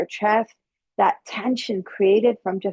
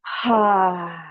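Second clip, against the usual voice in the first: release sigh. Shown as a demonstration. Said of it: "Let it go".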